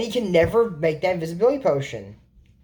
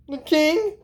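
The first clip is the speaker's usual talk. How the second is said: surprised squeak; 10 semitones higher than usual. Retold or imitated. Surprised character question.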